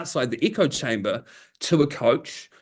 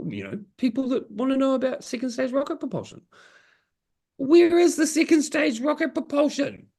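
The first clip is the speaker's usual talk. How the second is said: higher, imitating the engineer's self-assured voice; higher mock voice of a smug expert; mock know-it-all engineer; 11 semitones higher than usual. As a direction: parody the client as if it's obvious. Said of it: "And they're like".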